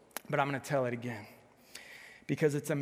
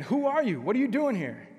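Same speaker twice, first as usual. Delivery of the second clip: loud, higher challenge from the wall; guard calling out; 7 semitones higher, 5 dB louder than usual. Acted. Roman guard challenges the wandering rabbi, loud call. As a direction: bark it like a sentry on the wall. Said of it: "He said again".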